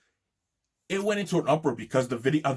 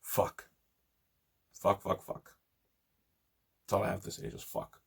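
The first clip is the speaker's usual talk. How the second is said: exasperated muttering; 7 semitones lower, 8 dB quieter than usual. Real, not acted.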